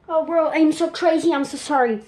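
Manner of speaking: put-on English with a French accent. Joking to viewers in English for them to rate the accent, playful English accent. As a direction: try out your best English accent, playfully. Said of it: "mon accent anglais sur dix".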